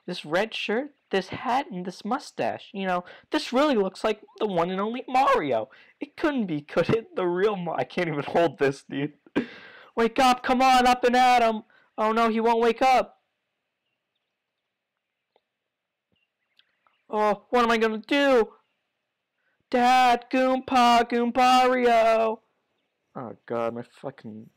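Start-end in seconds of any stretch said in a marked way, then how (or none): 0.0-7.8 put-on high-pitched girly voice, growing excited; high female character voice. Player reading Goompa's daughter, high female voice. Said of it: "one voice for every female character". 10.0-22.4 high and loud, calling in alarm; loud high female voice. Acted. Player voicing a worried girl calling for help, high and loud. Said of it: "my voice is already going out".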